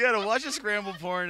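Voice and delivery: loud, high, cracking up; laughing teasing. Real, not acted. Host teasing his friend about late-night TV, laughing loudly. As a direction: tease him loudly through the laughter.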